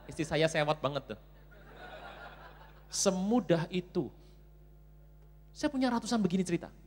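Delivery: ordinary talk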